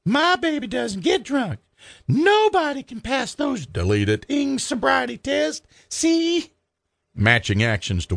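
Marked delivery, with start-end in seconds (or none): 0.1-3.7 much higher, shrill outraged mama; shrieking mother. Acted. Darla screeching at the cop over her son's arrest, high and outraged. 4.3-6.5 much higher, shrill outraged mama; shrieking mother. Acted. Darla screeching at the cop over her son's arrest, high and outraged.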